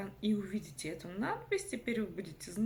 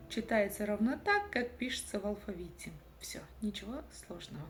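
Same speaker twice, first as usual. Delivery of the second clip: normal talking voice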